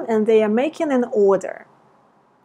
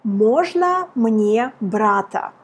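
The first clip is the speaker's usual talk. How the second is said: slow model phrase; slower than usual. Shown as a demonstration. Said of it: "you want to say".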